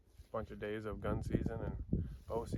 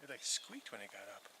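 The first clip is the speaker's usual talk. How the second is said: hushed, urgent whisper; wildlife watching whisper; 3 semitones higher, 12 dB quieter than usual. Real, not acted. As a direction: whisper right after spooking a deer. Hunters whisper after deer bolts.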